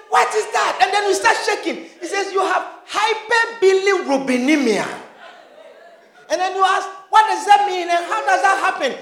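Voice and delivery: high, loud, half-laughing alarm; laughing mock panic. Preacher mimics a panicked patient questioning the doctor, laughing. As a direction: play the frightened patient for laughs.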